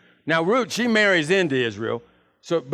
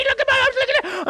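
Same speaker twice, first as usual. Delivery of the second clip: much higher, yelling with joy; ecstatic shouting; faster than usual. Acted. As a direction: shriek it out like a man just cured. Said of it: "he's going around going crazy".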